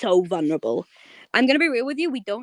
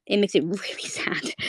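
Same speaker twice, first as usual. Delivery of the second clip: breathy whisper with a little laugh; whispered sadness. Real, not acted. Streamer sighing over the sad ending, whispered.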